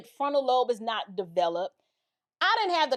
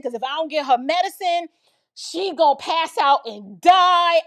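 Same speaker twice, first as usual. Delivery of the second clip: melodramatic pleading; 4 semitones higher, 5 dB louder than usual. Retold or imitated. Teen exaggerating niece's illness to officer, pleading. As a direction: mock-desperate excuse building to a loud finish.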